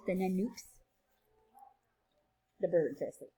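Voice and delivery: ordinary delivery, nothing marked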